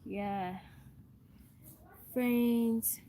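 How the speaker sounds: ordinary talk, nothing marked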